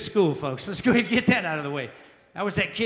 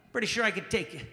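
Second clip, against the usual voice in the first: ordinary talk, nothing marked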